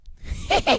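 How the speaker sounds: very high-pitched villain cackle; Joker laugh. Acted. Imitating Joker's laugh for the co-host, high cackle. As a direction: cackle high like a comic-book madman. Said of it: "He has the laugh".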